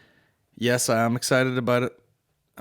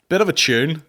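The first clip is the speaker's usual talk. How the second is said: loud, higher, appalled; disgusted outburst; 7 semitones higher, 8 dB louder than usual. Real, not acted. Reacting to the song's likes, loudly disgusted. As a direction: spit it out in disgust.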